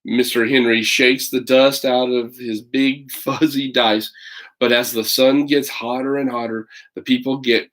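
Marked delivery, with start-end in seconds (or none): none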